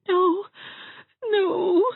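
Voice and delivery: very high, tearful whimper. A narrator's reading. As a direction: whimper it weakly, close to sobbing. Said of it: "whimpered Dovey".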